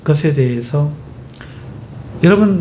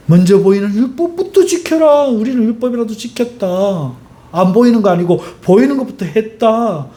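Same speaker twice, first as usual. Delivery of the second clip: indignant Pharisee; 5 semitones higher than usual. Retold or imitated. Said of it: "이런 모습 아닐까요?".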